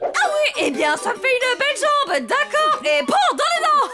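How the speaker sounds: plus fort et plus aigu, insolente et moqueuse; riposte effrontée. Acted. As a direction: clouer le bec au directeur en criant. Teacher defying the headmaster. (Mademoiselle Justine rembarre le directeur d'un ton effronté et fort)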